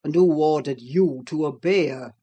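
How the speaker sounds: low, gruff challenge; lightly voiced harsh master; stern master. A narrator's reading. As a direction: Heathcliff rounds on Hareton, low and stern. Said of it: "he added, turning to Hareton".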